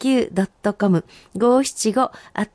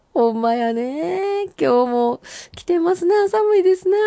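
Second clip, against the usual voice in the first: playful chatter; 8 semitones higher than usual. Real, not acted. High put-on chatter about cold.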